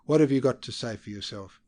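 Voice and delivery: loud, cutting demand; sharp question. A narrator's reading. First secretary demanding an account from Verloc, loud and sharp. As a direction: snap the question at him. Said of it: "he asked sharply".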